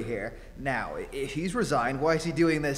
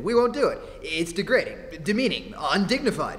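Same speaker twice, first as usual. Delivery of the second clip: indignant protest; 6 semitones higher, 4 dB louder than usual. Acted. Reporter protests the assignment.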